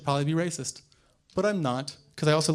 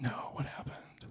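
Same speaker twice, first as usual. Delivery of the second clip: mock whisper; 4 semitones lower, 14 dB quieter than usual. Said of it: "I'm like".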